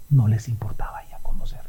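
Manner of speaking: a grave whisper to the congregation. Real, not acted. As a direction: lean in and whisper the accusation. Preacher whispers the point.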